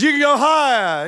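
a hint of an egging-on dad; daring father. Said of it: "the father pushes the swing".

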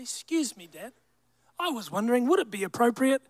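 soft, over-courteous little plea; hushed, exaggeratedly formal politeness; mock polite request. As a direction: timidly ask Dad, as a parody of good manners. Mock polite request the son never made.

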